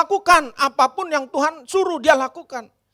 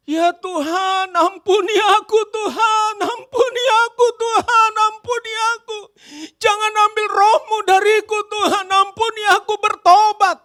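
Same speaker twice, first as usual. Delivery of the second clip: pleading prayer; 8 semitones higher, 4 dB louder than usual. Acted. David pleading for forgiveness.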